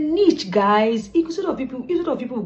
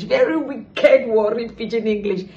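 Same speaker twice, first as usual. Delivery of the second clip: emphatic praise; 3 semitones higher, 6 dB louder, slower than usual. Acted. Exaggerates Vivian's pidgin.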